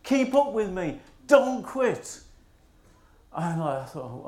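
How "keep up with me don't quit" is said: mock cheery shout. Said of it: "and he called to me".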